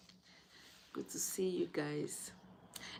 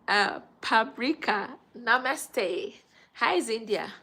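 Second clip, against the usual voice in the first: higher, louder hellos to viewers; excited greeting; 4 semitones higher, 9 dB louder, slower than usual. Real, not acted. Vlogger greeting viewers by name, excited and loud. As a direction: call out each name warmly.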